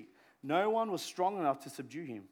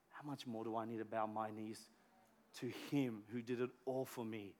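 lower, much softer plea; quiet awe; 8 semitones lower, 9 dB quieter than usual. Real, not acted. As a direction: draw them in, hushed. Humbled challenge to himself.

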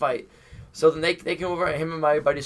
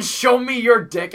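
raised, slow mock holler; retold yell; 7 semitones higher, 6 dB louder than usual. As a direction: quote the yell loudly, less than full force. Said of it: "Just yelling".

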